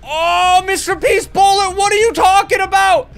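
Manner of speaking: high, loud, incredulous yell about "Mister P's bullet"; excited shout. Real, not acted. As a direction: shout it out in disbelief. Excited disbelief during the game.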